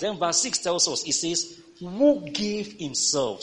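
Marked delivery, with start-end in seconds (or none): 1.8-3.4 slow, high, loud preached reading; lifted scripture proclamation. Acted. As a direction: proclaim each phrase from the pulpit. Reads scripture aloud.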